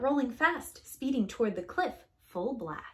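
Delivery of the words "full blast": usual speaking voice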